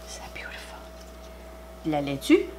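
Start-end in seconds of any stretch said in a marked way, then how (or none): 0.1-0.8 soft unvoiced whisper; awed whisper. Real, not acted. Vlogger whispering over her sandwich. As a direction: breathe the words in wonder. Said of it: "it just makes me whisper".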